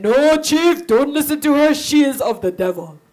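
loud, hostile sorcerer voice; scheming witch doctor. Acted. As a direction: hiss poison into the chief's ear. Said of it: "The witch doctor said".

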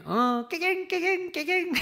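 high yipping "깨갱" like a kicked puppy; mocking yelps. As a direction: mimic the coworkers' puppy-yelp taunt. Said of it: "이랬어". (강아지 울음처럼 높게 "깨갱" 흉내)

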